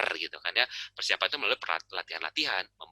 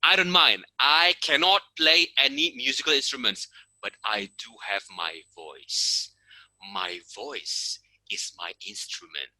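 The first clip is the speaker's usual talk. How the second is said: sincere resolve; slower than usual. Retold or imitated. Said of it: "saya pikir".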